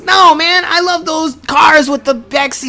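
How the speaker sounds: high exasperated dude. Retold or imitated.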